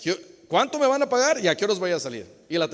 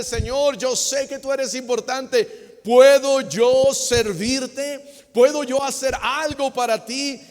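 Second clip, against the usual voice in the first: raised, high-pitched appeal to the Lord; earnest plea; 6 semitones higher, 6 dB louder than usual. Acted. Believer asks God to let him serve, earnest raised plea. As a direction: plead loudly and higher, offering to serve.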